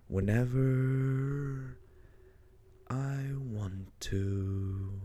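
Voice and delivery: slow, drawn-out words in a cave; cave echo. Shown as a demonstration. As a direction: stretch it out slowly with echo. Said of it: "Now it sounds like I'm in a cave".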